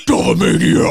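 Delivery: loud hammed-up muscle-bird bellow; mock tough-guy bird roar; beefcake bird. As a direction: bellow like a pumped-up wrestler through laughter. Acting out beefcake bird, laughing.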